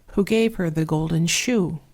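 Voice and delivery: plain reading voice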